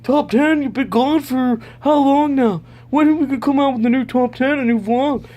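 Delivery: high, loud excited fan voice; eager fans. Pretend. Fans demanding the new top ten, high and eager. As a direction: squeal it like an impatient fan. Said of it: "Everyone say".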